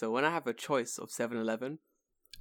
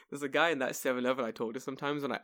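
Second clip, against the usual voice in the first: ordinary talk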